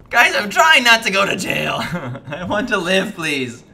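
loud, high comic protest; mock pleading. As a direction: plead loudly, half laughing at the trouble. Protesting to game characters.

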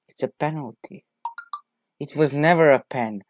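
usual speaking voice

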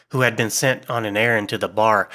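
plain reading voice

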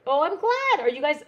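light up at the chat; excited. Real, not acted. Host delighted viewers craft along, excited.

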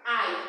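"I" said the English way, like "eye"; English letter name. Shown as a demonstration. Teacher showing pupils the English letter, "I" as "eye". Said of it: "se pronuncia".

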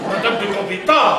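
loud, laughing reproach; scandalized exclamation. Acted. Butler scolds his mistress for her crude joke, loud and laughing. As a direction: Madame de Gambetta, shouted out loud through a laugh.

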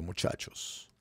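half-whispered mock radio announcer. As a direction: try a radio announcer's boom with a voice that's almost gone. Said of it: "voz de locutor".

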